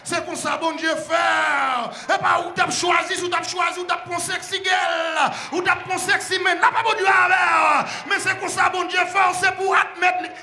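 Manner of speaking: loud, high shouted preaching; preaching at full force. Real, not acted. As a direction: drive the message home to the church. (prêche à pleine voix, criée)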